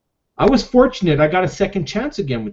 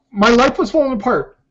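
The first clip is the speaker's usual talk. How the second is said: the feeling breaks through as he admits it; real emotion; 6 semitones higher than usual. Real, not acted. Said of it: "Sorry, I'm getting emotional".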